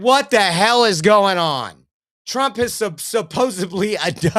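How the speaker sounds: loud, slow, hammed-up outrage breaking into a laugh; half-mocking a fiery congresswoman, cracking up mid-line; mock Maxine Waters. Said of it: "She said".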